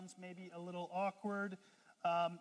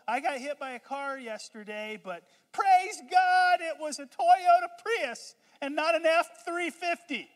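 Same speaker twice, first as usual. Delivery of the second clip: mock happy testimony, much higher and louder; hammed-up cheery believer; 8 semitones higher, 14 dB louder than usual. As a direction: play the over-the-top super-spiritual type, beaming. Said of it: "people that talk like that".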